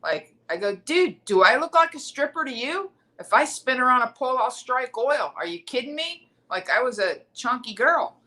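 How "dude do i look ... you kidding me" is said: high-pitched comic indignation; mock-offended, pitched up; retold incredulous retort. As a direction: act out snapping back at a heckler. Her retort to the heckler.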